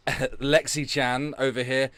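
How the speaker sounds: usual speaking voice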